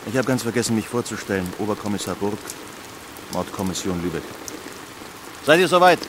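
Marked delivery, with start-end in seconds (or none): 5.5-6.0 high, loud call across the scene. Acted. Inspector calling to his colleagues across the scene, high and loud. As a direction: shout over to the team.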